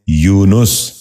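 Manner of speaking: یونس said as "yoo-nus", short u after n; correct pronunciation. Shown as a demonstration. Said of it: "درست تلفظ ہے".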